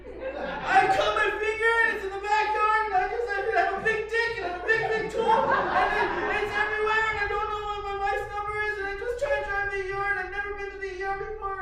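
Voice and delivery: high, loud, frantic babbling; over-the-top panicked rambling; hysterical panic. Retold or imitated. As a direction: blurt it all out in a shrill panic, racing. Panicked rant to cop.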